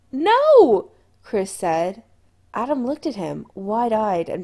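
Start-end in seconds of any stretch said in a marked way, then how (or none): 0.1-0.9 high, loud cry of no; sharp protest. A narrator's reading. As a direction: refuse at full voice. Chris objects to leaving port.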